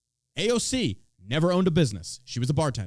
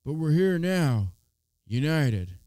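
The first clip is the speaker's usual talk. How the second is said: louder, put-on folksy Biden voice; mock Biden; 2 semitones higher, 3 dB louder, slower than usual. Mocking Biden's State of the Union unity line, lightly louder put-on voice. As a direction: parody a feel-good presidential speech. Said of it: "He's like".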